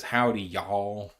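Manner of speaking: slow, drawn-out mock Southern "Howdy, y'all"; a clumsy Southern cowboy drawl on "Howdy, y'all", slowed right down; terrible Southern accent. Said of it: "That's a terrible accent".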